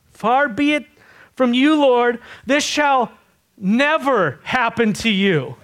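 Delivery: impassioned objection in short bursts; determined protest. Retold or imitated. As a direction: refuse it outright, high and emphatic. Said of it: "Peter was totally determined".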